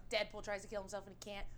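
ordinary talk

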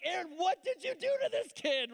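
high, loud, panicked question; alarmed boys yelling at their bloody-fisted brother; shocked alarm. Retold or imitated. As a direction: cry out in disbelief at your brother.

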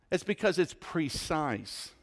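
usual speaking voice

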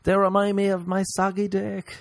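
high-pitched comic complaint; mock sex cry. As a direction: blurt it high, as if mid-sex. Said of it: "that I'm gonna say during sex".

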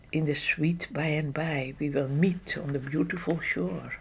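soft whisper, like singing far off; whispered song. Retold or imitated. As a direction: whisper the hymn as it fades away. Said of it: "Ik hoorde nog een tijd dat gezang".

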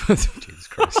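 high, breathy laughing gasp; shocked at his friend's crude joke, laughing. Real, not acted.

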